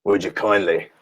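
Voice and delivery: light Irish accent. Shown as a demonstration. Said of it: "You gotta say it in an Irish accent".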